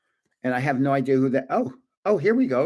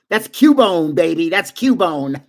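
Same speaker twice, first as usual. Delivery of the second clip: loud, gleeful call; triumphant excitement; 6 dB louder, slower than usual. Real, not acted. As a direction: crow it out loudly. Triumphant at recognizing a card.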